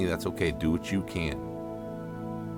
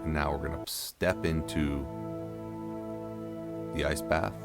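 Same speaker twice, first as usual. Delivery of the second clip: guided breathing; 7 dB quieter than usual. Acted. Guides slow breathing at start.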